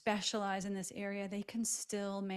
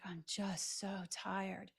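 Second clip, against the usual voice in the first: 1 worn-out patient; 4 dB quieter, much slower than usual. Retold or imitated. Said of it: "they're like".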